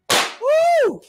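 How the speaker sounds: high whoop of joy; excited whoop. Real, not acted. Host hyping her audience about Wendy news, high whoop.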